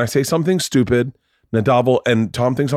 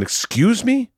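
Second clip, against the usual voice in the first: shocked manager; 10 semitones higher, slower than usual. Retold or imitated.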